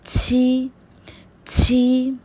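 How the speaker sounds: छी with air through teeth; airy छी; pinyin q sound. Shown as a demonstration. Teacher repeating pinyin q for practice. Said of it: "pronounce भी करते रहें".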